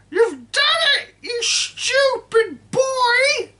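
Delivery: put-on high, loud screeching old woman; cranky grandma yelling from the roof; shouting grandma. A narrator's reading. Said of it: "Grandma shouted".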